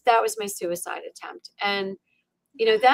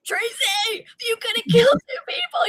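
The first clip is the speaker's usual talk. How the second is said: high, strained mock scream; airy, pitched-up self-scolding scream; retold screaming. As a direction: scream at yourself, breath giving out. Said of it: "I'm screaming".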